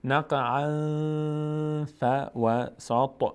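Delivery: slow recited نقعاً with a long nasal hum; nasal ikhfa. Shown as a demonstration. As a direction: draw out the hidden n of نقعاً into فوسط. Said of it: "بینی مخفی بشه".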